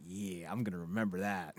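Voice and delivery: low, soft aside; quiet self-note. Retold or imitated. Fourteen-year-old him hears the line, low quiet resolve. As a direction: say it softly to yourself. Acts his impressed younger self.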